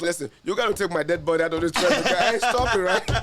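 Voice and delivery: to the host, quoting Will Smith mid-sprint, laughing and loud; Will Smith quote. Retold or imitated. Fired-up Will Smith line, breaking into laughs. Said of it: "I did what Will Smith is".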